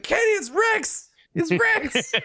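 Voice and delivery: very high, drawn-out excited yelp; excited squeal. Retold or imitated. Excited fan calls out to Kenny about spotting a droid, squealing high. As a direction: re-enact an overjoyed shriek, voice shooting right up. Reliving own excitement on seeing Rex.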